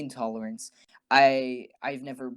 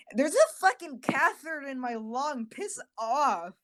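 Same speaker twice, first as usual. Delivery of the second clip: high, exasperated retort; irritated snap; 11 semitones higher than usual. Retold or imitated. To mom nagging him to move his arm, an exasperated high snap. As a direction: snap back at your mom, fed up.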